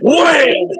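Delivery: loud, high impact noise; shouted punch sound. Retold or imitated. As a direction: yell the hit like a comic-book sound effect. Retelling being punched.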